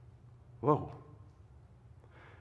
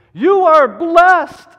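slow, loud declaration with a higher pitch; proclaimed blessing; 12 semitones higher, 16 dB louder, much slower than usual. Acted. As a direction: drag each word out and ring it to the back row.

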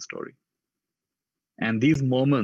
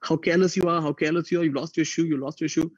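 higher-pitched, mildly raised scolding voice; retold scolding; 7 semitones higher than usual. Sister scolding her little brother over his lost shoe, mild mock shout. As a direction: scold him like a worried big sister. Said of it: "she began shouting at him".

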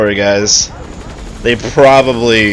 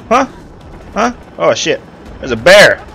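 ordinary talk

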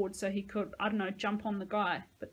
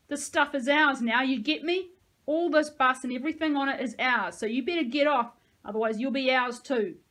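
menacing threat; 5 semitones higher, 9 dB louder than usual. Acted. Thug threatens the kids.

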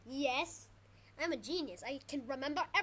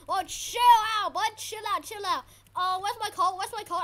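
loud, high frantic cries; panicked yelling; 4 semitones higher, 9 dB louder than usual. Real, not acted. Gamer under attack looking for the coat, panicked yelling. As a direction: yell as the attack overwhelms you.

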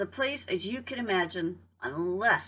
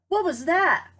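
high, louder startled cry; startled question; 8 semitones higher, 6 dB louder than usual. Acted. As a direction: jump at the sudden thud. Startled hen after acorn hits.

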